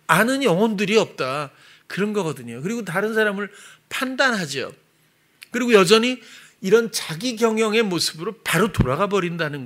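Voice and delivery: urgent, insistent preaching; impassioned preaching. Real, not acted. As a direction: drive it home to the congregation.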